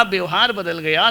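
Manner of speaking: normal talking voice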